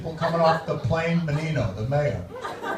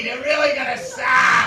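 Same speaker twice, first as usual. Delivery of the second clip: put-on grumpy Boston accent; 7 semitones higher than usual. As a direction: moan about the week like a loudmouth Bostonian. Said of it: "obviously from Boston".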